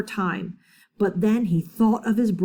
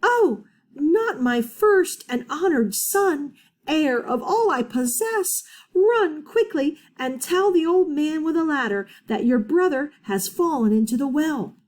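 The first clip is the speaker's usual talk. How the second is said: alarmed mother; 6 semitones higher, 3 dB louder than usual. Acted.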